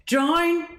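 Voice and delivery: high Brummie vowel, Jane as "Jine"; mock Brummie accent. Caller repeating her name in a mock Brummie accent. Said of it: "oh, the Brummie accent?".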